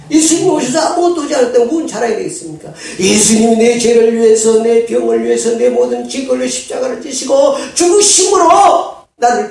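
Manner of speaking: full-force preaching. Real, not acted.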